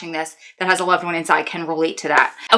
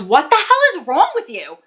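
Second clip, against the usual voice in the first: friend snapping; 7 semitones higher than usual. Retold or imitated. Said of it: "she goes".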